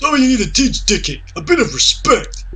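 put-on tut-tut at the unruly boy, voice up high; scolding villager.

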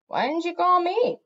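high, aggrieved cat complaint; demanding. A narrator's reading. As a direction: demand it like a left-out youngster. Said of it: "Trouble demanded".

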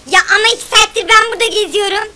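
loud, high, fast griping; furious outburst at co-player; angry complaint. Real, not acted. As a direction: rant at your friend for stealing food. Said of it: "Ya sinirleniyorum ama artık ha".

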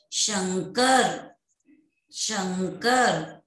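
शंकर very slow, nasal held before क; anusvara demo. Shown as a demonstration. Teacher models second example, slow शंकर with sounded nasal. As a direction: draw out शंकर so the nasal is heard. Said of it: "म की ध्वनि का भी उच्चारण".

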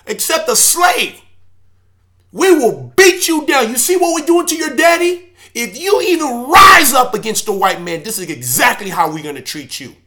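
high, loud mocking threat that builds to a shout; menacing oppressor threat. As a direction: bark it like the men beating him in front of his kids.

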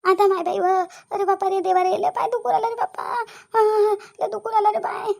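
loud, high hammed-up lament; theatrical moaning over a sore leg; fake pain wailing. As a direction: put on a show of agony. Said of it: "कंकुला, नाटक चालू कर आता आपलं".

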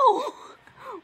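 very high squealing laugh; a short high shriek of laughter; a high, loud burst of laughter. Real, not acted. Reacting to the drawing.

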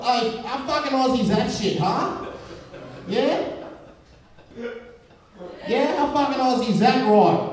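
high-pitched, swaggering mock Aussie; cocky Aussie bloke. Comedian playing an Aussie admiring his stuff, cocky and high. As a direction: pitch it up like a boastful Aussie showing off. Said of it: "you ever be like".

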